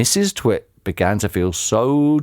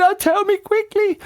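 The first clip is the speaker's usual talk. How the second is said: put-on much higher, dim old woman's voice; squeaky puzzled old lady; Missus Twit voice. A narrator's reading. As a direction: play her as a high, befuddled old wife.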